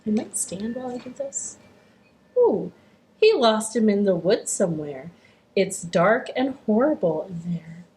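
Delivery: ordinary delivery, nothing marked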